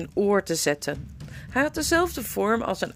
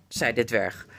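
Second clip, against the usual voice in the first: usual speaking voice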